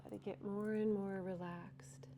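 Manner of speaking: soft, unhurried breathwork guidance; guided breathwork. Acted. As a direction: guide the class gently into the stretch. Leads breathing and relaxation.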